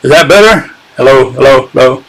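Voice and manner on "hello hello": ordinary delivery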